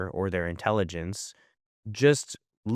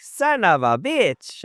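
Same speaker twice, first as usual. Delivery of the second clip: put-on high, drawn-out Mexican-accented curse; super Mexican accent; 10 semitones higher, 4 dB louder, much slower than usual. Parent imitating Gio copying the curse, super Mexican accent. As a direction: stretch it out in a thick Mexican accent. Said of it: "And a super Mexican accent".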